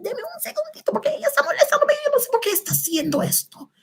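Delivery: very high, fast, thin squeal of a voice; squeaky complaint. Pretend. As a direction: rattle it off in a tiny squeaky voice. Mock plea after offering to mute.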